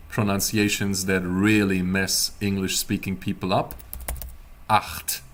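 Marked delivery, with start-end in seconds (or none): 4.7-5.2 Acht with a harsh ch from the back of the throat, like gargling; German ch in Acht, voiceless and gargled; throaty acht. Shown as a demonstration. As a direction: rasp the ch of Acht deep in the throat. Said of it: "It happens in your throat".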